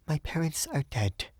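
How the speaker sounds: high, soft woman's voice; a touch of hushed grief; subdued young Frenchwoman. A narrator's reading. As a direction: say it high and quietly.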